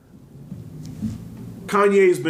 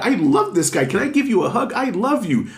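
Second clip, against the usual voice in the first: mock adoring, pitch climbing higher; gushing, voice going up; 7 semitones higher than usual. [name] gushing over [name], mock voice going up higher. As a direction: gush like a fan hugging his idol. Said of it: "when your voice goes up a couple of octaves".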